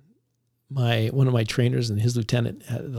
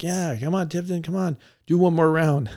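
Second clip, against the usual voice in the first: higher, eager encouragement; excited cheering; 7 semitones higher than usual. Retold or imitated. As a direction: cheer him back to life. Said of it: "they're like".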